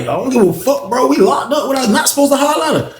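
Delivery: high-pitched indignant retort, retold. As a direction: protest in disbelief, pitch way up.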